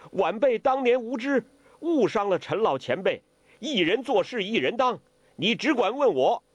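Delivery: high-pitched, insistent declaration; earnest young challenger. A narrator's reading. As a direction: take the blame boldly, voice pitched high. Young hero confronts avenging enemy. (年轻人高声恳切担当)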